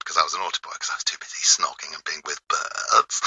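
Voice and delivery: unvoiced conspiratorial whisper. Real, not acted. Whispering to the DJ about snogging as a schoolboy, whispered confession. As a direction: hush it like a naughty secret. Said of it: "confessing naughty schooldays".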